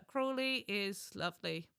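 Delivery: ordinary talk, nothing marked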